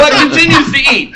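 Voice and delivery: high, laughing through the words. Real, not acted. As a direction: get it out between laughs. Laughing at the funny scene.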